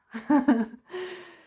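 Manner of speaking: loud burst of laughter. Real, not acted. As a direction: laugh out loud at your own remark. Laughs at own humor remark.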